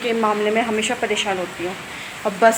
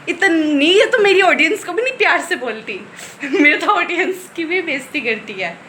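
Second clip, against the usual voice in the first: mock complaint; 5 semitones higher, 3 dB louder than usual. Real, not acted. Complains about her sister.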